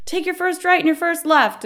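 high-pitched imitated call; mock-urgent high voice; urgent directions. Desk guy calling out urgent directions.

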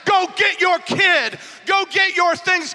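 high, loud, slow preaching shout; shouted exhortation. Real, not acted. Preacher urging congregation to take back what was stolen, slow shouted exhortation. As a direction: punch out each command slowly and loudly. Urges congregation against the enemy.

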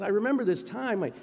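a touch of tearful emotion, retold; imitating tearful Corden. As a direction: lightly act someone choked up by a memory. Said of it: "James Corden is just crying tears".

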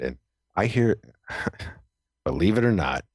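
ordinary talk, nothing marked

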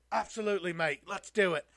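hammed-up British accent agreeing; Tyson Fury impression. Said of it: "That sounds just like him".

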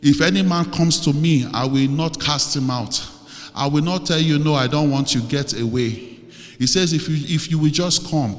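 loud, insistent preacher quoting scripture; fervent scripture declaration. Acted. Declares scripture within the prayer.

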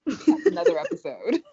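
high, loud laugh through words. Real, not acted. Laughing off family topic.

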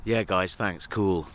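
low, laid-back drone; Neil. Acted. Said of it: "I'm doing Neil".

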